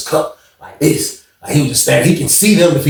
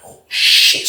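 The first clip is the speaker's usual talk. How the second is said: whispered. Retold or imitated. Reacting quietly in the pod.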